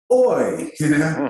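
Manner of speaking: playful impression of a tipsy Australian boss calling in; Australian accent. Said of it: "he's this Australian guy".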